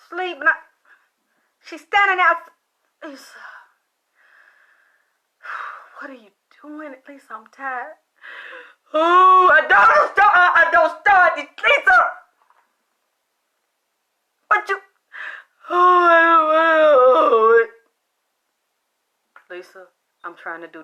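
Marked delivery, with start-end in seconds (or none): 3.0-7.9 weary sigh, then whispered question; tired whisper. Retold or imitated. Teller woken by a ghost at night, sighing whisper. 8.9-17.7 louder, higher, whimpering plea; mock crying plea. Dead friend begging the teller at night, mock crying plea. Ghost Lisa pleading, crying.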